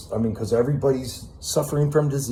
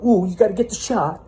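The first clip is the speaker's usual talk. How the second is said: high, whiny mock of a compliant person; mocking sheep voice; 8 semitones higher than usual. To his audience, mocking those who obey, high and whiny. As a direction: sneer it like a gullible follower. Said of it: "And you're not gonna go".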